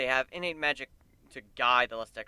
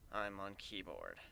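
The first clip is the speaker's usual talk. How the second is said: soft, slow, deflated mumble; a bit depressed; 6 semitones lower, 15 dB quieter, slower than usual. Acted. Admitting to the band she's stuck on keyboard, deflated. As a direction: drop it low and glum. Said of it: "I say the last part a bit depressed".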